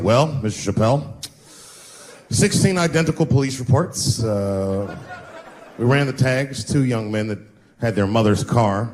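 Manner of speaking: deadpan desk-sergeant; police officer. Retold or imitated. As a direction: report the facts officially.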